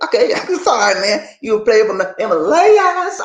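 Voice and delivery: high, loud, laughing mock apology; amused, high-pitched ribbing; laughing mockery. Real, not acted. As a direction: tease him loudly through laughter. Mocking a self-declared player.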